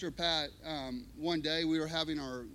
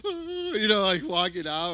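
shaky cry; 8 dB louder than usual. Retold or imitated. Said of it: "shaking inside".

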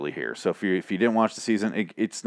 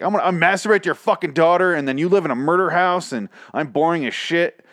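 high, loud hammed-up rant; imitating a bizarre patient, pitched up; mock unhinged patient; 7 semitones higher, 4 dB louder than usual. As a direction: parody a client at level ten. Imitates bizarre patients at level ten.

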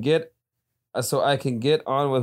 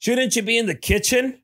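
mock chauvinist taunt; 11 semitones higher, 3 dB louder than usual.

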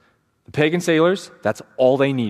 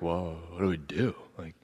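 stunned sailors; 9 semitones lower, 14 dB quieter than usual. Retold or imitated. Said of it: "they're like".

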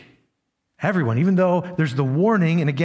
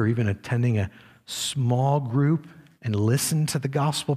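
quiet earnest appeal; 8 semitones lower, 4 dB quieter than usual. Acted. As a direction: draw the listeners close with a hushed, serious warning. Quiet earnest altar call.

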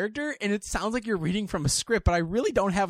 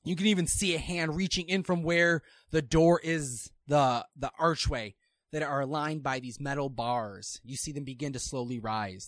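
usual speaking voice

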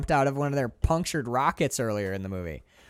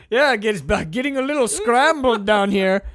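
loud, high comic backpedalling; mock flustered; 5 semitones higher, 7 dB louder than usual. Crewman with the gun giving in to his captain, mock flustered. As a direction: get worked up and pitch it higher.

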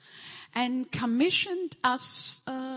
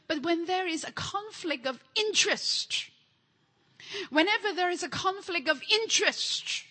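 preaching build; 7 semitones higher than usual. Real, not acted. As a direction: build each line higher and harder. Preacher building repeated questions.

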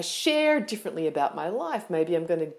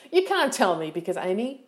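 ordinary talk

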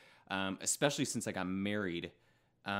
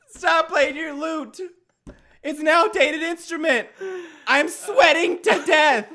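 much higher, louder, slow; high whiny complaint; 16 dB louder, slower than usual. Acted. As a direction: whine at Brent who isn't listening. Scolding lute player in skit.

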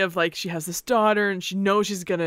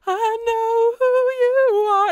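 imitating Moana; 5 dB louder, much slower than usual. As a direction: mimic the movie heroine, half crying. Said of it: "she's just like".

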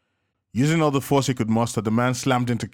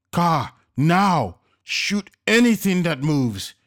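urgent commands; 7 semitones higher, 5 dB louder, much slower than usual. A narrator's reading. Casper orders Navina under fire.

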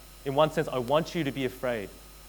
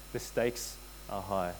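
softer, slower echo; 7 semitones lower, 9 dB quieter, slower than usual. Acted. Repeated softer.

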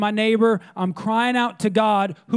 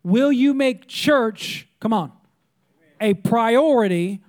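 usual speaking voice

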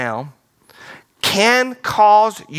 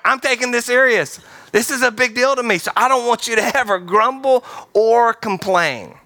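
higher-pitched, emphatic voice of God; God in earnest; 6 semitones higher than usual. Acted. God warning his people against complaining, emphatic and high. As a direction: lay it down as a big deal, pitched up. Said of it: "And God's like".

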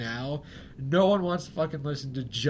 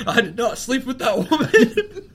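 higher, louder, slow mock presidential denial with a laugh; Bill Clinton; 9 semitones higher, 9 dB louder than usual. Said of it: "Are you saying that Bill Clinton".

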